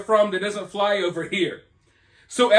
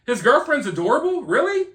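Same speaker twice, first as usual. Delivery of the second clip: disbelieving rise on really; can't believe what he's reading; incredulous; 5 semitones higher than usual. Real, not acted. Vlogger reacting to fan praise, incredulous.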